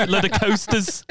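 laughing through the quip. Retold or imitated. Telling friends what she said while carrying the chips, laughing. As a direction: giggle out the words.

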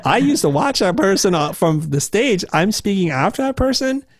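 can't believe you're following your idol; imitating a stunned, intimidated speaker; daunted disbelief. Acts dread at following idol.

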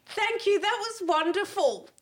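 high, slow, gushing posh gush; Lady Diana. Retold or imitated. Comedian reenacting her gushing thanks, a high, slow royal gush. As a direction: gush like royalty, stretching out each word. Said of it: "I turned into fucking Lady Diana".

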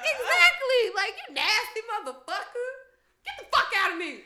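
loud, laughing disgusted outburst; laughing outrage. Retold or imitated. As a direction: blast him while cracking up. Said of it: "I would've been like".

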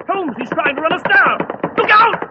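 loud, high warning yell; alarmed shout. Acted. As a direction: scream a warning as the horses charge. Cab charges at them.

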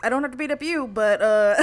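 playful mock invitation. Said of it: "did she just kinda go".

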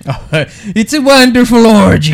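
high, loud gushing director voice; hammed-up film director. Podcaster mimics a gushing director. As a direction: gush over the scene like a sleazy director.

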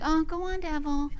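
soft, limp dismissal; quiet, feeble mock command; mock half-hearted. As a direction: say it without meaning it. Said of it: "you know, mean it".

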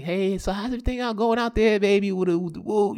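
quick, high, casual greeting; chirpy small talk. Retold or imitated. Ex making small talk after the move, chirpy.

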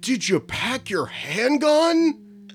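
slow, loud, high mock-dad question; Keanu Reeves riffing. Imitating a dad riffing with his kid, slow, loud and high. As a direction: build to a drawn-out silly peak on handgun. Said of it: "And he's like".

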